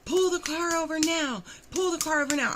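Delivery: high, pressing order; urgent command. Retold or imitated. Telling the overdosing boyfriend on the phone to stop, urgent. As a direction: bark it down the phone, worried. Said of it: "And I'm like".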